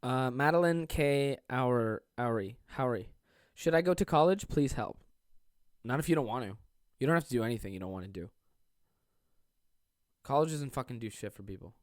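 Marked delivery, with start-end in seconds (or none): none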